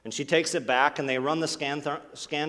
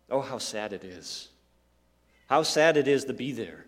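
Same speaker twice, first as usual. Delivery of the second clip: normal talking voice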